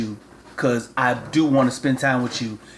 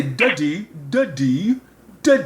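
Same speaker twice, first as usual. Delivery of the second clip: Duddy said with a light British accent, short u in Duddy; coaxing Duddy in a mild British accent; a dad demoing Duddy in a British accent for his son. Shown as a demonstration. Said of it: "If you start using that accent".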